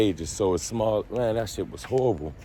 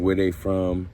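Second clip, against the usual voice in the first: ordinary delivery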